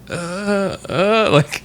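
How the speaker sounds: high, strained uh sounds; mock frightened stammer. Coworker stammering at the locked door, high and frightened. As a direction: stammer in panic. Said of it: "The guy's like".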